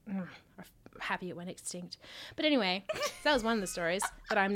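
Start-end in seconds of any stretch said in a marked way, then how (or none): 0.1-2.0 unvoiced, soft muttered relief; whispered aside. Real, not acted. Whispering to her co-host about the dinosaur. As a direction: drop to a whisper. Fading whisper after a rant.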